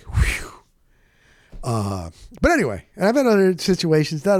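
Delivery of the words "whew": whispered whew of relief; breathy sigh of relief. Retold or imitated. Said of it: "And I was like".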